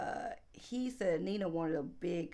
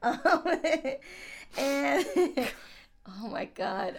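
high, laughing through words; trying not to laugh; 7 semitones higher, 6 dB louder than usual. Real, not acted. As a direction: fight back the giggles. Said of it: "I'm trying not to laugh so early".

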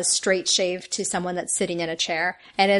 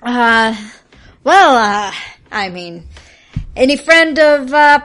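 effusive barber; 7 semitones higher, 8 dB louder, slower than usual. Acted. Barber warms up, in character.

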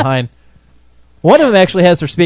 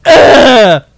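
deep grunt; 6 semitones higher, 7 dB louder than usual. Retold or imitated. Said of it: "probably sounded like".

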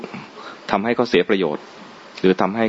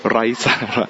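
high laugh then laughing word; 7 semitones higher than usual. Real, not acted. Laughing at audience reaction. (หัวเราะขำ)

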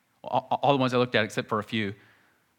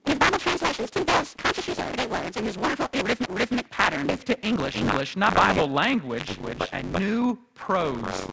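fast, high, lively read-aloud quote; excited book reading; 8 semitones higher than usual. Acted. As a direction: read the scholar's words with enthusiasm. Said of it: "Listen to his, one of his books".

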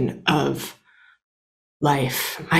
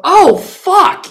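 realizing partner; 11 semitones higher, 5 dB louder than usual. Retold or imitated. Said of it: "he gets to go".

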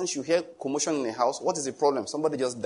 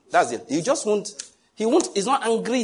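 ordinary talk, nothing marked

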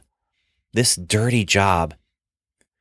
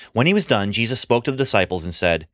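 plain reading voice

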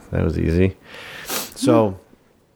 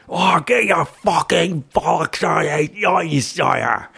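higher, slow put-on Scottish captain; mock Scottish accent; 7 semitones higher, slower than usual. The captain dismissing the frightened soldier, in a hammed-up Scottish accent. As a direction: ham up a Scottish officer scolding a soldier. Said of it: "What was that?".

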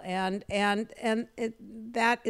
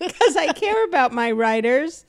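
mock sincere boss; 4 semitones higher, 8 dB louder than usual. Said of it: "had you ever gotten that".

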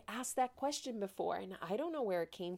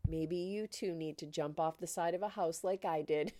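normal talking voice

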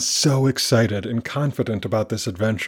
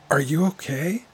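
high, questioning lift of worry; pretended concern; 6 semitones higher than usual. A narrator's reading. Amina playing along for the driver, pretended concern. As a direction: ask with raised pitch, feigning fresh concern. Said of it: "She tries to pretend".

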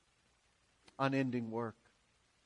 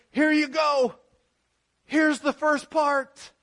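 higher, louder hammed-up call; mock Jesus shouting; 8 semitones higher, 6 dB louder, slower than usual. Preacher imagining Jesus on the cross, mock shout. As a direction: toss it off like handing over a half job. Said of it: "he's hanging there and he says".

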